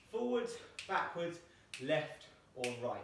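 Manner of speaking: forwards, backwards slowly spelled out; drawn out like a drill call; slow clear commands. Shown as a demonstration. Teacher demonstrates the direction calls, slow and deliberate. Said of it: "So I can either say to you".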